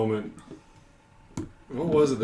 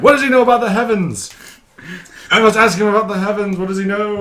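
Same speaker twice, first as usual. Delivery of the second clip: high, loud hermit exclamation; urgent outburst; 11 semitones higher, 8 dB louder, faster than usual. Acted. Hermit hearing a stranger mention the heavens, urgent outburst. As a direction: cry out in hammed-up alarm.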